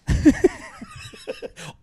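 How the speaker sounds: shrill burst of laughter at own joke; giggling at his own insult; high squealing laugh. Real, not acted. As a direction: crack up high.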